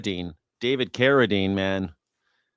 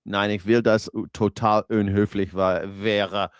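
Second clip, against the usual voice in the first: mock German; slower than usual.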